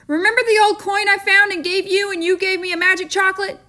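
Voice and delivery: put-on a frantic boy pressing the shopkeeper; demanding boy. A narrator's reading. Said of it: "he demanded".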